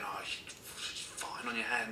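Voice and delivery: urgent whisper to a friend; whispered panic to a mate. Retold or imitated. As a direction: hiss it under your breath so the teacher can't hear. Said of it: "I'm looking at Chad going".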